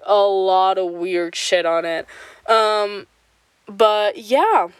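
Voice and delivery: ordinary talk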